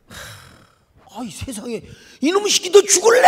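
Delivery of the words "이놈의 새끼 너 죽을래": high, loud, fast snarling yell; vicious threat. Retold or imitated. Technician threatens the little boy, loud fast vicious yell. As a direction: bark it like a brute threatening a child.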